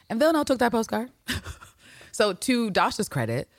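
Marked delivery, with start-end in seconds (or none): none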